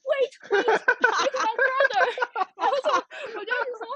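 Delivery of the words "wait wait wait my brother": high, loud, pleading sorry in English; frantic apologizing. Retold or imitated. Older sister begging foreigners on the bridge to wait, panicked. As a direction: beg strangers to wait, flustered.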